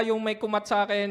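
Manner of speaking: usual speaking voice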